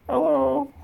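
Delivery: tiny, very high squeaky puppet voice; hacky sack puppet. Acted. Streamer voices his hacky sack puppet, squeaky and high. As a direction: squeak it far above your own voice. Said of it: "a little hacky sack wants to say hello".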